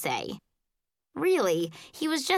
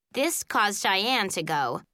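ordinary delivery, nothing marked

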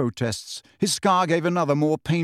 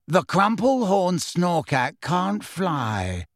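very slow, higher, dignified voice; 5 semitones higher, much slower than usual. A narrator's reading. Luna correcting Ron about her creature, dignified. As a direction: draw each word out with injured dignity. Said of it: "in a dignified voice".

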